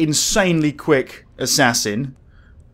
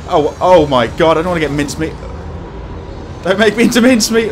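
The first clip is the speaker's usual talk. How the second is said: scared horror game streamer; 4 semitones higher, 6 dB louder than usual. Real, not acted. Chainsaw killer nearby.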